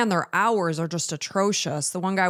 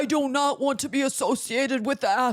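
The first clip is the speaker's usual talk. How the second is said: mocking teen son; 6 semitones higher than usual. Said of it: "He's like".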